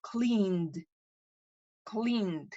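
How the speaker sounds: "Cleaned" as one syllable ending in a voiced d; clear pronunciation demo. Shown as a demonstration. Teacher models "Cleaned" with a d ending. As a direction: say "Cleaned" like "cleend". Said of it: "se va a pronunciar como una D".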